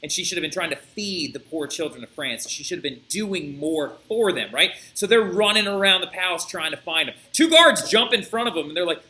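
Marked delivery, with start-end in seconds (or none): none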